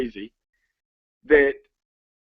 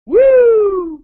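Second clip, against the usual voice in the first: excited whoop; 8 dB louder than usual. Real, not acted.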